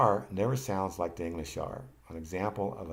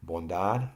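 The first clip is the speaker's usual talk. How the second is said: Bondad with a louder final DAD; stress on DAD; 5 dB louder than usual. Shown as a demonstration. Teacher showing learners the accent, DAD stressed in Bondad. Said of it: "the accent is on D A D".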